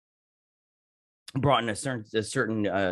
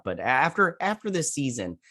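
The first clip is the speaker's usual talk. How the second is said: ordinary talk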